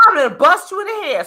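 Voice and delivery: high, fast joking threat; mock threat. Joking threat at a rude husband, high and fast. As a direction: rattle off a playful threat.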